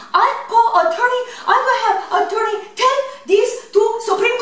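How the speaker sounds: high, indignant put-on Japanese accent; mock Japanese accent. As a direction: threaten a lawsuit in a fake Japanese accent. Prank caller lectures the manager.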